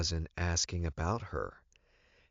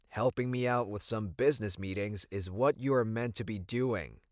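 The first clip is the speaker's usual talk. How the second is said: louder, higher, scornful retort; fired in anger; 5 semitones higher, 4 dB louder than usual. A narrator's reading. Alpha firing back angrily at Elizabeth. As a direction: fire the words back at her. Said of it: "I fired in anger".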